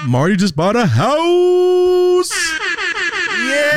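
real thrilled announcement; excited shout. Real, not acted. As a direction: yell the big news to the world. Announcing friend's big news.

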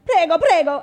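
imitating shop staff. Italian shop staff about masks, loud imitation. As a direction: mimic the pushy Italian clerks. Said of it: "they're like".

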